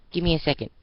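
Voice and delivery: high, hurried and eager; dashing off to change into the costume. Acted. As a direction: rush it, she can't wait. Adagio hurries into car.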